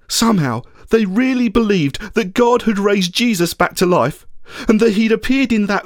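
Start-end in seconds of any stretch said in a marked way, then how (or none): none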